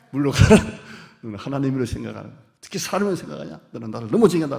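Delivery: 크게 몰아치는 꾸중, 빠른 말; 버럭 소리치며 몰아세우듯. Pretend. 교인을 꾸짖는 장로 흉내. (장로를 빠르고 크게 몰아세우는 호통)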